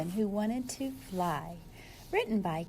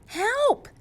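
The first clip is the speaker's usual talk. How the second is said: high, loud panicked cry; cry for help; 12 semitones higher, 8 dB louder than usual. Acted. Penguin crashing into the sea, high panicked cry. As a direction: call out as you hit the water. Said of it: "cried Flip Flop".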